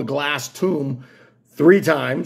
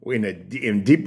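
mock Latin American accent; slower than usual. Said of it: "this sounds Latin American and that's not right either".

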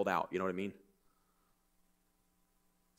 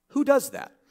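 high, loud disbelieving question; incredulous; 11 semitones higher, 5 dB louder than usual. Acted. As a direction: throw it up in disbelief. Rhetorical disbelief.

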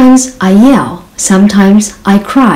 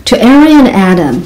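ordinary delivery

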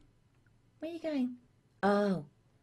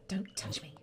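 whispered warning; quiet whispered don't touch me; 11 semitones lower, 6 dB quieter than usual. Real, not acted. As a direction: hiss it low, annoyed. Whispered repeat of demand.

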